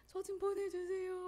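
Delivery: 높고 느리게, 울먹이며 "저 좀 보내주세요"를 애원; 흐느끼듯 매달리며 보내 달라고 빌기. Retold or imitated. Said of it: "막 엉엉 울고". (주님께 선교 보내 달라고 울며 매달림, 흐느끼는 간청)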